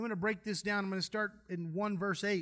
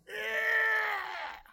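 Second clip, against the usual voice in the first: mock retching; 11 semitones higher than usual. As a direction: hurl the sound out like being sick. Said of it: "bent over like this going".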